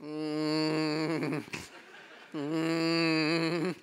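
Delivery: long monotone drone; imitated bee hum. Stray bees ignoring instructions, imitated monotone hum. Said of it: "Fifteen percent of the bees go".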